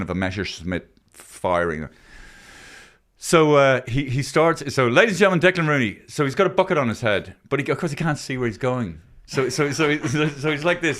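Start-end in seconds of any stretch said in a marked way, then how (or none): 4.9-6.0 higher stage introduction, lightly voiced; stage announcer. Host retelling the act's introduction to a crowd, announcer. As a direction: introduce the act like an MC.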